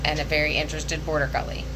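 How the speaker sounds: usual speaking voice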